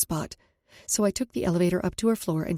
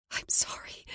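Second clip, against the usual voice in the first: whispered apology; 7 dB quieter than usual. Acted. Apologizing through tears.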